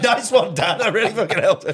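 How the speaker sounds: high, loud sarcastic thanks; mock exasperated complaint. Son blames dad for armlock lesson, sarcastic yell. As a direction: shout thanks you don't mean at all.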